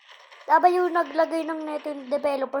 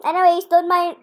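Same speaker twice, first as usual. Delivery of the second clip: usual speaking voice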